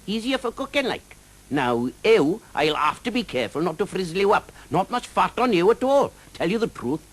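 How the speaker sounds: high, lilting Welsh dragon voice, teasing the knight; a cheeky Welsh dragon mocking a bad singer.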